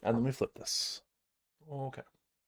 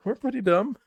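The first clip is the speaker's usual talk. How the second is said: cheeky boast; 11 semitones higher, 7 dB louder than usual. Acted. Playful banter, loud high.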